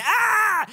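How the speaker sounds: high, loud yell of pain; hammed-up high scream of pain; acted cry of pain. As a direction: yelp loudly as if badly hurt. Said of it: "I fall to the ground in pain".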